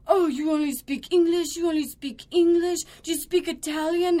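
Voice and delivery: loud, high-pitched indignant questions; imitated angry yelling. Irate guest challenging the clue master, mock yelling. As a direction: play a ranting guest demanding another language. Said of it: "yelling in Italian at me".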